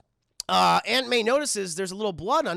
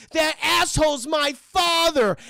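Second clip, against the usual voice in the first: higher, louder, slow outburst; hammed-up furious defense; angry son; 6 semitones higher, 6 dB louder, slower than usual. Harry defending his father, hammed-up shout.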